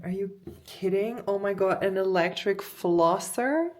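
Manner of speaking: excited disbelief. Real, not acted. Unboxing flosser.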